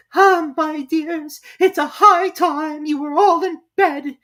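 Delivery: high, loud, slow fearful mother bird; trembling. Acted. Canary calling its children, high and slow. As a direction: hurry the chicks off. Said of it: "in a trembling voice".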